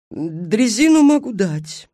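high, drawn-out woman's voice; obliging station mistress. Acted. Station mistress offers stranded travellers a railcar, high and obliging. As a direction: voice a helpful countrywoman, pitched high and slow.

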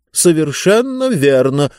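self-satisfied, know-it-all; smug book voice. Acted. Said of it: "самодовольный голос книги".

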